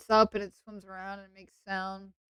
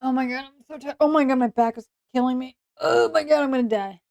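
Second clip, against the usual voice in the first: higher, louder hammed-up agony; dramatic complaining; 4 semitones higher, 6 dB louder than usual. Streamer whining to viewers about back pain, dramatic complaining. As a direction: moan like your back is killing you.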